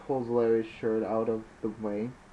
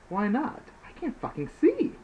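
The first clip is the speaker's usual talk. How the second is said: high, loud, frustrated; 12 semitones higher, 4 dB louder than usual. Acted. Larry who can't see snaps at Snake, high and angry.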